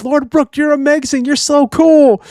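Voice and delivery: very high gushing fangirl squeal; adoring fans. Retold or imitated. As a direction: swoon over him in a high voice. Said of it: "they're all praising Brooke".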